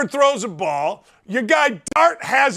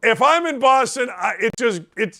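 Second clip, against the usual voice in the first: normal talking voice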